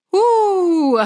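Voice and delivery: high, loud choked whoo holding back tears; teary exhale. Real, not acted. Reader telling viewers about the ending, a choked tearful whoo. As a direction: let out a tearful breath. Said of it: "I'm actually getting teary-eyed".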